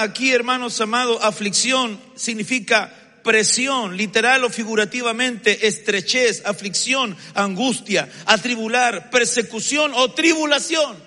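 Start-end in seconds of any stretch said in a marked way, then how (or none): none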